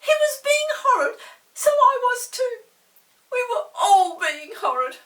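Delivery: very high, loud tearful plea. Acted. Molly confesses to Peter, tearful. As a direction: plead through tears. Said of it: "almost in tears".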